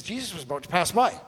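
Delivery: quick, rising to a startled finish; shocked rereading. Acted. Preacher showing how shocking the passage reads, quick and incredulous. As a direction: read it out as if you can't believe it. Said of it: "you'll find it shocking".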